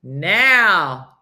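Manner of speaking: normal talking voice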